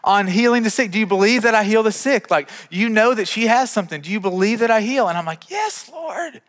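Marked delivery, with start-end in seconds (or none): 0.0-5.1 higher, louder, insistent divine questioning; the Lord challenging. Retold or imitated. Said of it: "and the Lord's like". 5.5-6.4 squeaky-high mock submission to God; meek high reply. Acted meek answer to God.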